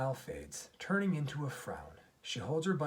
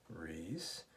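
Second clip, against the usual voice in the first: low, softer name with a rising lilt; slight inflection; 7 semitones lower, 7 dB quieter than usual. A narrator's reading. Daddy prompting Reese at bedtime, slight inflection. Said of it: "says with a slight inflection".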